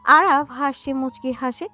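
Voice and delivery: ordinary delivery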